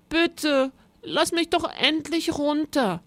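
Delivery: high, plaintive begging; pitiful pleading. A narrator's reading. Rosa begging the monster to release her, pitiful. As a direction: beg miserably in a high voice. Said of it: "sagte Rosa kläglich".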